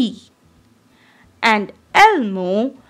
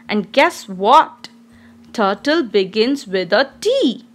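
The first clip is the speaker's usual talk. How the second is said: ordinary talk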